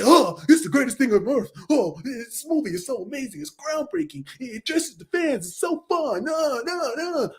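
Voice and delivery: mock Marvel fan gush. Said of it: "everyone would say".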